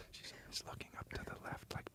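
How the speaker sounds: barely audible whisper; faint, hushed ASMR question. Pretend. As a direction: whisper very softly into the mic. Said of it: "Can you do some A S M R".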